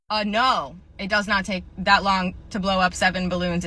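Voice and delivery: normal talking voice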